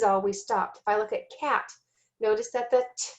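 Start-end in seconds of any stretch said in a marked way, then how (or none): 3.0-3.1 T as the sound alone, not the letter name; isolated T sound. Shown as a demonstration. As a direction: say T as a bare, crisp consonant sound. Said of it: "stops the short A".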